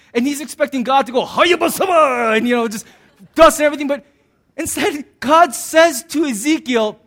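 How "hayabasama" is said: higher, slow, shouted incantation; mock magic spell. Preacher imagining God casting a flashy spell, hammed-up shout.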